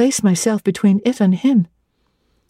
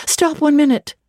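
raised, high call to stop him; cried out; 7 semitones higher than usual. Acted. Jane calling Rivers back at the door, raised. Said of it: "I cried".

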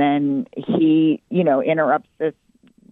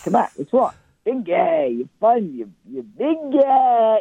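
put-on goofy husband voice; husband impression; 6 semitones higher than usual. Husband calling comic wife big guy, playful put-on voice. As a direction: tease affectionately in a put-on voice, stretching the final words. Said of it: "Give me some of your husband".

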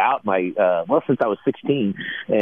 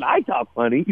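surprised realization; 4 semitones higher than usual. Retold or imitated. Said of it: "And I remember thinking".

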